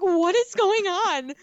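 high, slow, loud disbelief; exasperated outcry. Retold or imitated. Viewer reacting to a blaring movie, exasperated outcry. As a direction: wail it slowly, high and loud. Acts out own baffled reaction.

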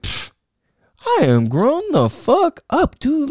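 high, slow, scoffing know-it-all; cocky young self. Retold or imitated. Imitating his cocky twenty-year-old self to a friend, scoffing. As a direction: brush it off like a twenty-year-old who thinks he's seen it all. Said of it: "twenty, I'm like".

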